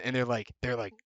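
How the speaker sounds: usual speaking voice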